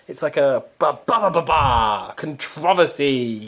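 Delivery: slow, rhythmic syllables leading into "controversy"; sing-song ba ba ba. Shown as a demonstration. Delighting in a Welsh-sounding word, sing-song. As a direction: bounce through each syllable. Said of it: "so much more fun to say".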